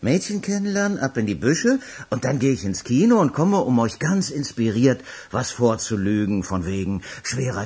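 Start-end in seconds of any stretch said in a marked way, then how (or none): none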